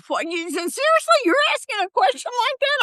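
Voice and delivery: high-pitched mock disbelief; acted shock, voice jumping up high; incredulous. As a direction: squeal out the outrage, way up high. Incredulous retort to Bob.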